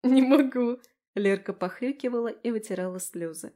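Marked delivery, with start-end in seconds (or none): 0.0-0.8 loud, higher exclamation; helpless protest. A narrator's reading. Tipsy friend told to stop laughing, helpless protest. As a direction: protest loudly that you can't help it.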